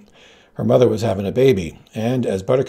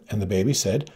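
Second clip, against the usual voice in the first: ordinary delivery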